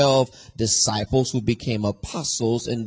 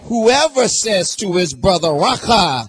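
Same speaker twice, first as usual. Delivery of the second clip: loud, rising preacher's climax, "whoever" hit hard; preaching at full force; 3 semitones higher, 4 dB louder than usual. Acted. Preacher driving home the verse to his congregation, fervent. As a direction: build the scripture to a fiery peak.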